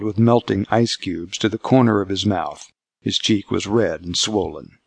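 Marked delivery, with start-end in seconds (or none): none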